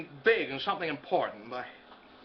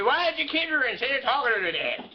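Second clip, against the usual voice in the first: put-on higher, wailing Irish old man from the porch; creepy Irishman; 8 semitones higher, 4 dB louder than usual. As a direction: holler it in an Irish brogue like a porch crank. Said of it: "creepy Irishman starts wailing at him".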